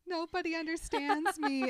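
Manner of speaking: high, hammed-up teen sulk; Regina moping to Gretchen, high mock whine; melodramatic whine. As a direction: wail it like a spoiled teen. Regina sulking dramatically.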